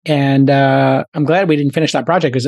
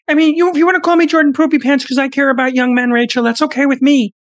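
high, tense lecturing voice; Jordan Peterson impression; 10 semitones higher than usual. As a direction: preach about young men, pitched high. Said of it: "does Jordan Peterson's voice not sound almost perfect?".

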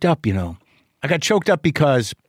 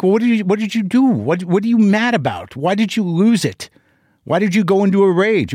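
high, fast, exasperated demands; exasperated questioning; 7 semitones higher than usual. Retold or imitated. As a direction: grill him, fast and frustrated. Confronting father on phone.

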